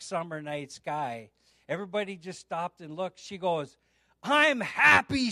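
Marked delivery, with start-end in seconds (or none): 4.2-5.3 high, loud, slow proclamation; joyful announcement. Retold or imitated. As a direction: proclaim it to the room, acting her out. Acting announcement to congregation.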